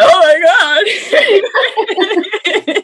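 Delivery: high, loud gushing oh my God; mock overwhelmed. Mother overwhelmed watching teary husband, loud gushing. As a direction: swept away by the moment. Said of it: "'Cause I'm like".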